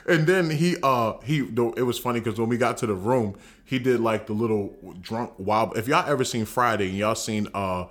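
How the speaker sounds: ordinary talk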